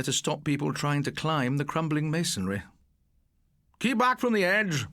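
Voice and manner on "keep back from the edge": ordered. A narrator's reading. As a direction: bark a warning to the class. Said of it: "ordered Mister Candy".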